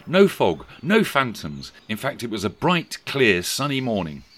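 ordinary delivery, nothing marked

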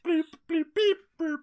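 high-pitched electronic beeps and bloops; techno bloops. Retold or imitated. Mocking the How It's Made soundtrack, techno bloops. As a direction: imitate bloopy synth music with the mouth. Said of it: "techno bloopy music in the world".

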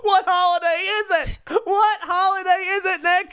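high, squeaky put-on voice, laughing through it; mock clueless question. Co-host teasing the Christmas talk in a squeaky put-on voice. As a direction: play dumb in a silly high voice.

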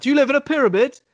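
clueless person grilling an Egyptian, loud and high; mock bigoted question. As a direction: blurt it out wide-eyed.

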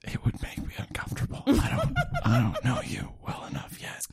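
soft joking whisper; ASMR whisper. Pretend. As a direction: whisper with a little laugh.

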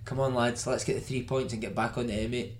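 usual speaking voice

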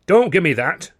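sharp, loud, high snap; snapped. A narrator's reading. Carno snaps at Dando, angry and loud. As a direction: snap it at him, cutting him off. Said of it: "he snapped".

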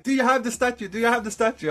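high, loud acted interrogation; urgent demand. Monk urgently questions him, acted.

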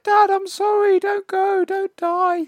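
very high, loud mock wailing; hammed-up little girl begging Dad; child's fit, imitated. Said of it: "Tina has another fit".

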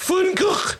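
Van Gogh as throaty "foon-KOKH"; exaggerated guttural kh closing Van Gogh; mock mispronunciation. Guest mimicking a big fan of the painter, mock mispronunciation. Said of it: "I've heard people say".

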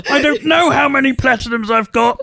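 excited Rosie. Retold or imitated. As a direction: gush like a thrilled gamer. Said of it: "impression of Rosie being excited".

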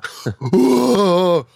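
loud, high laugh; burst of laughter. Real, not acted. Mother telling of the wild party boys, laughing loudly. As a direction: laugh out at the chaos.

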